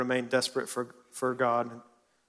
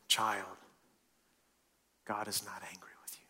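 soft whispered reassurance from God; tender whisper; 6 semitones lower, 12 dB quieter than usual. Shown as a demonstration. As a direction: breathe it like comfort to a child. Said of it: "I'm gonna say this a different way".

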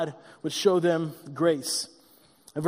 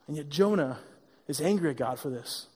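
hushed emphasis; 6 semitones lower, 6 dB quieter than usual. Real, not acted. Preacher drops voice for emphasis.

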